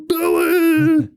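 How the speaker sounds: high, loud mock crowd cheer. Recapping the party, mock crowd cheering Billy. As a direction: shout the name like a surprise party.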